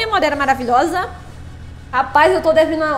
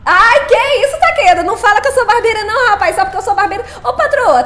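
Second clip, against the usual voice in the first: loud, higher-pitched playful objection; play-indignant outburst, pitched up; mock protest; 4 semitones higher, 6 dB louder than usual. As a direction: protest loudly in mock offence. Mock protest at viewer's teasing.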